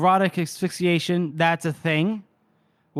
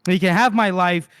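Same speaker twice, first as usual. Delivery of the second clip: ordinary delivery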